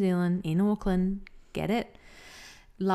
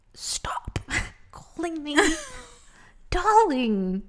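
higher, half-laughing plea to stop; exasperated plea; 12 semitones higher, 4 dB louder, slower than usual. Retold or imitated. Customer pleading with the barista to stop, half-laughing. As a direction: beg him to drop the pet name, laughing.

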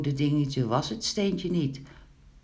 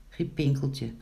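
ordinary delivery

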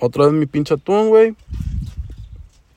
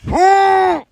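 pained high gasp; 4 dB louder than usual. Retold or imitated. Hurt pride after rejection.

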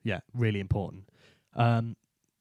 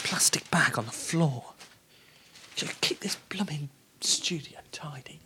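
whispering; 3 semitones higher, slower than usual. Real, not acted. Whispered muttering to self.

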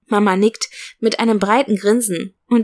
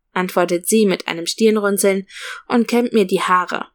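ordinary delivery

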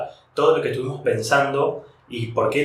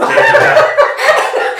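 high squealing laughter through "sí, sí, sí", much higher and louder, slow; laughing; 10 dB louder, much slower than usual. Real, not acted. Host cracking up at the guest's reaction, squealing laughter. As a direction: crack up completely.

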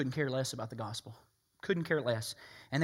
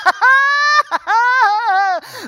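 mock laugh; 18 dB louder than usual. Mock laugh of proud harvester.

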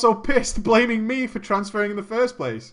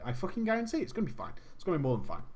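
much softer, trailing self-assurance; acted-out unconvincing comfort; muted reassurance; 2 semitones lower, 11 dB quieter, much faster than usual. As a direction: reassure under your breath.

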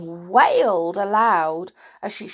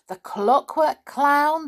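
ordinary delivery, nothing marked

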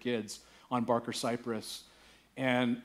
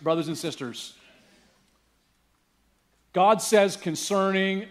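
usual speaking voice

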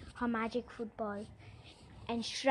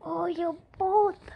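high, loud, slow furious goblin; squeal of rage; 7 semitones higher, 8 dB louder, slower than usual. Acted. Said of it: "he let out a squeal of rage".

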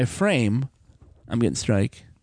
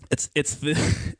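usual speaking voice

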